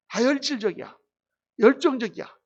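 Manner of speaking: ordinary talk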